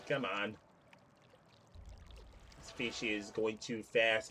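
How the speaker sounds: ordinary talk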